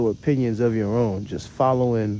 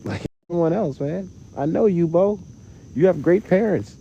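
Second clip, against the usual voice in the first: louder, higher scolding; louder, higher incredulous telling-off; exasperated rant; 7 semitones higher, 6 dB louder than usual. Real, not acted. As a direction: call them out, half laughing at them. Ranting at copycat imitators.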